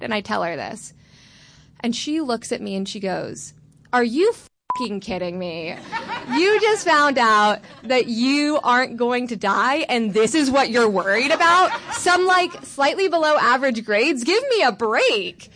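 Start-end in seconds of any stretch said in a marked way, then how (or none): 3.9-15.5 exasperated mom. Retold or imitated. Mom reacting to grade worry.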